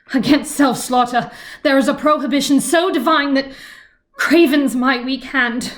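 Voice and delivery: grave, halting dramatic recital; earnest tragic stage speech; Imogen. Acted. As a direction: offer yourself to death, pausing heavily. I will be reciting Imogen's monologue.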